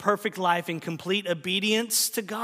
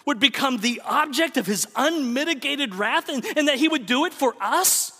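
impassioned preaching; 5 semitones higher than usual. Acted. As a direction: preach it with rising fervor toward the climax. Preaching at full force.

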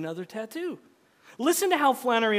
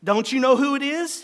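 high, loud desperate question; raised-voice, exasperated appeal; anguished cry; 7 semitones higher, 8 dB louder than usual. A narrator's reading. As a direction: plead with her in acted anguish. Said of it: "he cried in anguish".

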